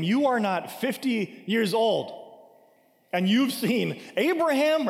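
slow, high-pitched scoffing question; slow mock astonishment at an absurd claim; mocking disbelief. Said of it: "they're just mocking him".

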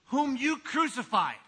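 "you" leaned on, slow and weighty; stress on YOU. Shown as a demonstration. Preacher quoting Peter to the crowd, stressing "you". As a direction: land hard on "you" in "whom you crucified". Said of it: "he throws these beautiful three words in there".

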